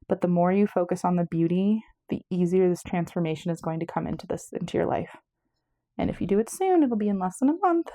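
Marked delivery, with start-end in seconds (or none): 6.0-7.9 out of breath. Real, not acted. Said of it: "Oh, I'm out of breath".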